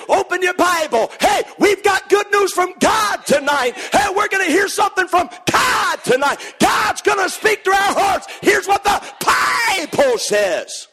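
high shouted revival preaching; mock fiery preacher. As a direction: pound the pulpit like a fired-up preacher. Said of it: "preacher ... says".

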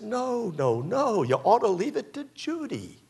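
mock pushy wife pleading her pick; insistent wife. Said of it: "And she said".

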